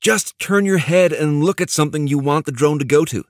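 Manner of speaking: high-pitched, eager impatience; exasperated but excited. A narrator's reading. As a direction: urge them on, exasperated yet thrilled. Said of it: "Neil sounded exasperated, but excited".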